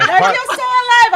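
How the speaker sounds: very loud, high-pitched shout; imitating an exhilarated scream of joy; retold elated yell. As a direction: yell it out like a thrilled kid. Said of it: "just kept yelling".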